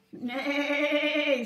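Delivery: loud, high hammed-up horse neigh; whinny it out like a pony for the kids. Teacher voicing the horse for kindergarten, loud playful neigh. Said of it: "said the horse".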